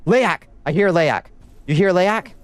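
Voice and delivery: high startled call-out; alarmed call-out. Real, not acted. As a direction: sound the alarm to chat. Alarm at the enemy's sound.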